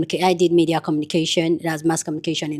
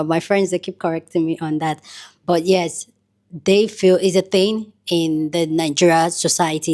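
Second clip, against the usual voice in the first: ordinary delivery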